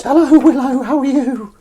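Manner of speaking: very high, loud cooing to a cat. Shown as a demonstration. Host demonstrating how they coax Willow over, high cat voice. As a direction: squeal a greeting at the cat in falsetto. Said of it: "my cat voice to Willow is".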